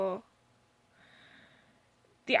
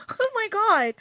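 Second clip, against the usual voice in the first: high, loud Oh my god!; shocked exclamation; 11 semitones higher, 10 dB louder, slower than usual. Real, not acted. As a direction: gasp it out in disbelief. Shocked reaction to comment.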